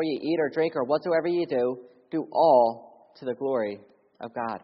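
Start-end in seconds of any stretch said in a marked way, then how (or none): none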